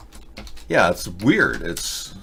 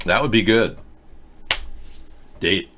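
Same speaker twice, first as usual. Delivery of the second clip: ordinary delivery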